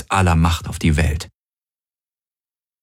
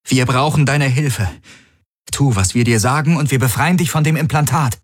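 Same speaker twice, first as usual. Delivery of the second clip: firm, raised demanding voice; hard; 8 semitones higher than usual. A narrator's reading. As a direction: say it with full hardness. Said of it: "sagte Kirai mit aller Härte".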